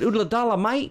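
high, hammed-up Aussie twang; fake Australian accent. To an Australian asking where he's from, a hammed-up fake Aussie accent. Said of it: "couldn't hear his accent because he was from Australia".

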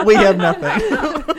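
slow words through laughter; laughing too hard to talk. Real, not acted.

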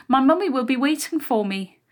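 put-on high, babyish taunt; mocking mimicry. A narrator's reading. Bully parroting the younger girl's excuse, mocking. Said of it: "the smaller one mimicked".

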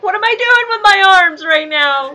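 high, loud panicked actor; imitating a confused actor on stage, high and loud; mock flustered actor. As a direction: play a lost actor fretting about his arms. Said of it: "are up there going like".

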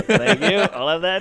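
high, loud cheeky gloat; smug thanks. Retold or imitated. Shopkeeper pocketing the fleeing kid's money, high smug gloat. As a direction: gloat over the dropped coins.

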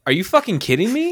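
as if bread just hit you; outraged disbelief. Retold or imitated. Customer hit by bread, high outraged disbelief. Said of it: "I was like".

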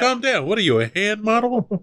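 high-pitched exasperated wise-guy outburst; mock freak-out in a high voice; Joe Pesci losing it. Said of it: "like Joe Pesci losing his shit".

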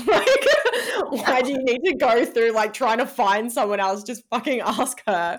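high, loud laughing disbelief; laughing exasperation. Real, not acted. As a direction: laugh through the obvious point. Laughing at her own realization.

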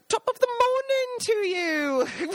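high, slow, over-cheerful hello; Jason's cheery greeting. Retold or imitated. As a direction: sing out a jolly greeting to strangers. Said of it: "Jason would be like".

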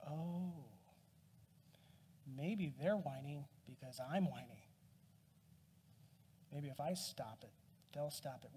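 low, soft, acted dawning realization; quiet realization. Father realizing his kids copy his whining, hushed retold self-reflection. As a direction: let it sink in softly, with pauses, as if thinking aloud.

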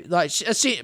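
normal talking voice

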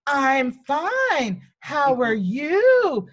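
high, slow copy of her sing-song; mimicking the teacher. Child copies the teacher's greeting, high and slow. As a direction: mirror her slow sugary tone right back at her. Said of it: "And so I say".

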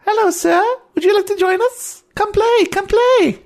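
put-on very high, piping child voice; little girl. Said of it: "one of the little girls notices you, and she goes".

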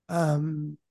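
normal talking voice

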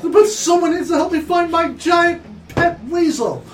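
high, loud desperate plea; hammed-up distraught pet owner; shouting. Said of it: "he shouts to you".

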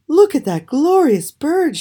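high, loud princess's exclamation; excited cry. A narrator's reading. Princess spotting the glorious bird, crying out excitedly. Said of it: "she cried".

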